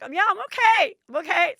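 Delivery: high, loud, teary "I'm okay"; choking out that she's fine through tears; tearful reassurance. Retold or imitated. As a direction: mom crying in front of strangers. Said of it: "Now I'm just off crying".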